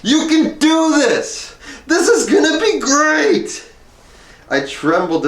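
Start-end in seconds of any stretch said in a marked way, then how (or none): 0.0-3.6 high, loud, laughing yell of joy; ecstatic scream. Retold or imitated. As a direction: shout it in his face, cracking with laughter and near tears. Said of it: "screamed in my face".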